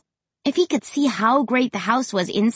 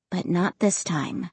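usual speaking voice

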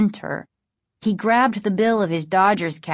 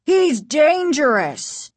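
high, slow, emphatic warning; alarmed high exclamation; exclaiming; 7 semitones higher, 4 dB louder, slower than usual. A narrator's reading. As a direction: warn them urgently, pitched up and drawn out. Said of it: "the other Doctor Brewer exclaimed".

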